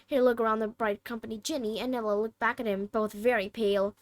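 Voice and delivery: ordinary delivery, nothing marked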